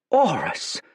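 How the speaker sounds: lightly anguished call; a hint of shocked grief; anguished cry. Fate cries out to his old friend, anguished.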